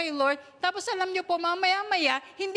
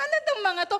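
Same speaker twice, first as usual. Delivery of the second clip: laughing despair; 6 semitones higher, 3 dB louder, much faster than usual. Retold or imitated. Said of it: "Sabi ko".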